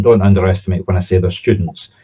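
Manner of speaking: ordinary talk, nothing marked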